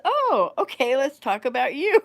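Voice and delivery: loud, high, imitated enthusiasm; mock-eager reply. Son agreeing to mother's request, mock-eager. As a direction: deliver the punchline high and loud, near laughing. Son's teasing punchline, acted loudly.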